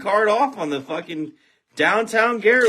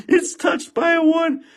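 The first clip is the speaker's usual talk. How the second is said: realizing the card is a big hit; excited; 8 semitones higher than usual. Real, not acted. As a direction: say it again as it dawns on you. Rereading after realizing the hit.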